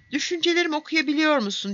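quick, high-pitched astonished question. A narrator's reading. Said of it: "diye sordu hayret ederek".

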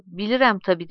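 ordinary delivery, nothing marked

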